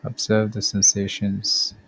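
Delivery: calm, measured body-scan guidance with long pauses; guided meditation. Acted. Podcast host guiding listeners through a body scan, calm meditation voice. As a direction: lead the listener gently through each step, unhurried.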